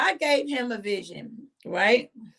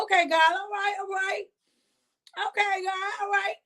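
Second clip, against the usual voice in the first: high resigned okay; 8 semitones higher than usual. Retold or imitated. Acting her answer to God.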